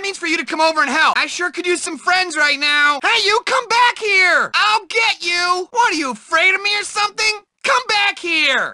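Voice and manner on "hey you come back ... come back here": high shout after someone; calling after. Acted. Nerd yells after someone running away, high. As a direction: yell at the fleeing kid.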